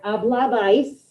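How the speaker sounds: Hablabais said slowly and carefully, every syllable clear; slow model word. Shown as a demonstration. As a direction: stretch out Hablabais for the class to copy.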